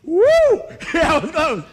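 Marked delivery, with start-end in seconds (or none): 0.0-0.9 very high, loud whoop; buddy's whoop. Retold or imitated. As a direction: imitate a friend's wild cheer. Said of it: "My buddy's like".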